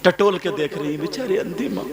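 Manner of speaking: high, loud, slow weeping sermon; impassioned preaching. Acted. Preacher crying out the story of Uwais and his mother. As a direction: preach the mother's grief at full voice.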